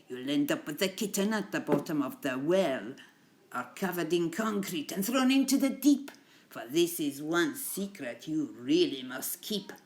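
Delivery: put-on amateur Italian mobster accent, lively and emphatic. Teacher reading Mafia Cats to pupils, attempted Italian accent. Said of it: "my Italian accent is like".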